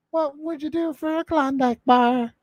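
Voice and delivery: sing-song it like the ad jingle; jingle parody. Acted. Host riffing on a viewer's question, high jingle voice.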